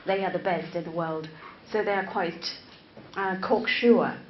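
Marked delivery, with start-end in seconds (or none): none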